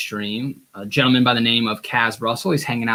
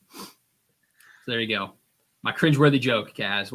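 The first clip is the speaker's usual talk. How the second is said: usual speaking voice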